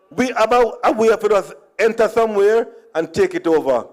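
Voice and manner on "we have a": normal talking voice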